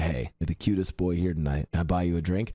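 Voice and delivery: low-pitched imitation of a big guy; imitated low, deep pickup line. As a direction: drop down low like a ripped dude flirting. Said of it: "deep voice".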